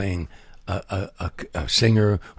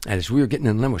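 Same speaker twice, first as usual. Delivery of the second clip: usual speaking voice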